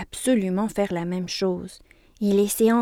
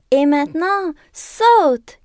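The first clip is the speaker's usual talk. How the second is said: loud, high group cheer; shouting; 8 semitones higher, 8 dB louder, slower than usual. A narrator's reading. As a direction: yell it up to the bed. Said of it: "crient les jouets d'une seule voix".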